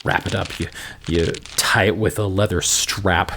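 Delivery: low, even scene-setting voice; game master narration. A narrator's reading. GM describing the room to the player, low and even.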